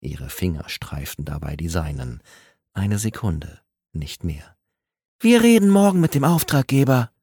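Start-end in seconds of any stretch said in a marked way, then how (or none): 5.2-7.1 smooth professional. Acted. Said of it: "Ihre Stimme war wieder glatt, professionell".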